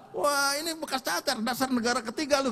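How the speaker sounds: high-pitched retold jeer; mocking exclamation. Commander jeers at his smallpox scar, mocking.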